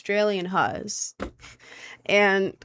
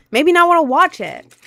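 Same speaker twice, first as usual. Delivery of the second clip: higher and louder, fed up; loud exasperated repeat; 7 semitones higher, 8 dB louder than usual. Real, not acted. Repeating to chat that the show was ruined, loud and fed up. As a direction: blurt it again, exasperated. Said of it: "and it made me not wanna watch it".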